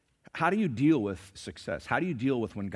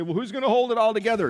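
worried quote; 11 semitones higher, 6 dB louder, faster than usual. Retold or imitated. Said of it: "You say".

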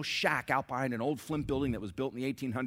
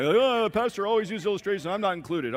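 higher, louder whiny churchgoer voice; mock complaining listener; 8 semitones higher, 8 dB louder than usual. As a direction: play a sulky member who feels left out. Mocking a left-out churchgoer.

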